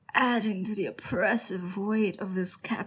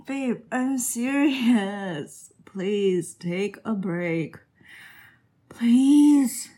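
pleading; 3 semitones higher, 9 dB louder, much slower than usual. Real, not acted. Begging partner to take break.

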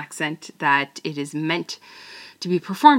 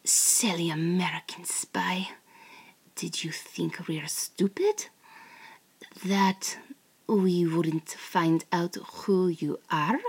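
teasing captor. Acted. Captor taunting the tied-up American spy, sultry and mocking. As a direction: toy with the captured spy in a sultry whisper. Performs the script in character.

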